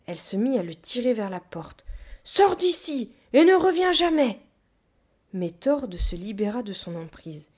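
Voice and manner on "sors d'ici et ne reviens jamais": high, loud furious shout; ordre furieux. A narrator's reading. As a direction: throw him out at full voice. Gorilla throws Tord out. (crier de colère, voix aiguë)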